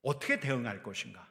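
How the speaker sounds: normal talking voice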